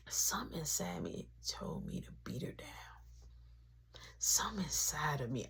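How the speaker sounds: whispered low, like admitting a secret; whispered confession; hushed confession. Real, not acted. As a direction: breathe the words out as a whisper. Confessing urge to hurt mom.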